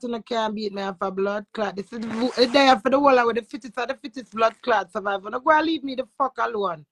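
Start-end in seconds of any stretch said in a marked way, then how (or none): none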